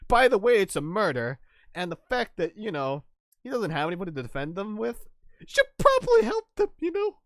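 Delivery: squeaky high cartoon voice rising to a falsetto; mock character voice. Stranger urging the lawyers to defend, hammed-up squeaky voice. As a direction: ham up a pushy stranger. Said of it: "he was like".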